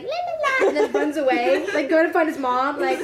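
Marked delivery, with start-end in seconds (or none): 0.0-0.7 put-on very high, loud child's la-la; imitated carefree kid. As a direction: trill like an oblivious toddler. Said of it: "The kid's like".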